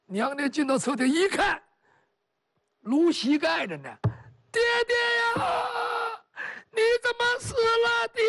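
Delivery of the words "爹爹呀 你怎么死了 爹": wailing grief. Acted. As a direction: cry out to the dead father, wailing. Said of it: "放声大哭". (高声哭诉)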